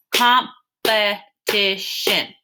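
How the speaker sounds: competition spoken slowly, syllable by syllable; syllables sounded out. Shown as a demonstration. Teacher counting syllables in competition, slow and deliberate. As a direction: tap out each syllable of competition. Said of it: "let's see how many syllables".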